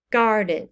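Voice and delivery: "garden" with GAR stressed and the second syllable falling away; stressed then unstressed. Shown as a demonstration. Teacher gives a trochee example, first-syllable stress. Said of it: "so it goes stressed and then unstressed".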